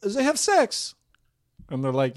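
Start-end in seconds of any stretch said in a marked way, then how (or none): 0.0-0.9 high mock Russian accent; a high, stunned Russian girl; high, surprised imitation of the Russian girl. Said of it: "So she's like".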